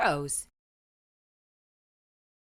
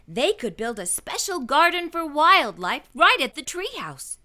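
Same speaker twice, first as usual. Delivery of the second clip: usual speaking voice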